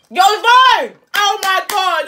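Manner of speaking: high loud yelling; shouting. Real, not acted. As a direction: yell it in shock. Shouting at phone call, far.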